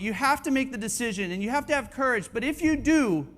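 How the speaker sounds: high, loud, driving exhortation; fervent preaching. Real, not acted. Preacher urging each man to step into his purpose, fervent. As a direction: preach it hard, pressing on without letting up.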